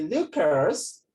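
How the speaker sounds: "Lucas" stretched in a put-on foreign accent, rising; international pronunciation. People addressing the student, mock international "Lucas". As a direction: draw out the second syllable of "Lucas" with a lilt. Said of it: "imagine people say".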